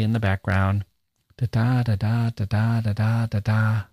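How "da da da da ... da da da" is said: sing-song stress pattern on da; iambic da-da rhythm. Shown as a demonstration. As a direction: tap out the iambic beat on da. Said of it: "Here's how a sonnet sounds to me".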